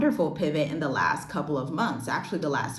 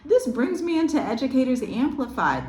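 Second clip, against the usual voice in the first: high, fast, delighted segue; excited; 11 semitones higher than usual. Real, not acted. Host plugging her own program to viewers, high and thrilled. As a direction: gush about the happy coincidence.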